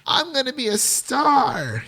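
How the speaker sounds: high-pitched aspiring starlet; star-struck film quote. Acted. Host quoting the film to co-host, high starlet proclamation. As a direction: declare it high, like a movie star in the making. Acting out film's star catchphrase.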